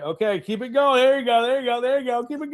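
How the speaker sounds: high, rapid-fire encouragement; retold frantic cheering-on; urgent egging on. Retold urging co-host to keep talking.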